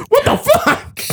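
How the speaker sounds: very high-pitched squeal of horrified surprise; mock shocked shriek. George finding blood on his face, mock shocked shriek. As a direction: shriek it, hammed up, as if just splattered.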